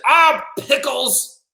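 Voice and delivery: high, drawn-out grumble of disappointment; upset boy. Acted. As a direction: whine it slowly in a high child voice. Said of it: "He's kind of upset".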